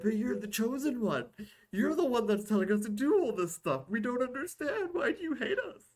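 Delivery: high, whimpering voice breaking into a cry; hammed-up betrayed follower pleading; whiny QAnon fans. QAnon fans begging Garrison, high whiny crying plea. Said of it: "And being like".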